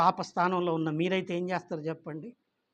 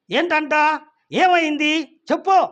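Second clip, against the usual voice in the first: higher, louder angry demand; angry child; 8 semitones higher, 8 dB louder, slower than usual. Acted. Daughter angrily demands mother explain, loud and high. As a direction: snap back at mother. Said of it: "కోప్పడుతుంది ఆ పాప".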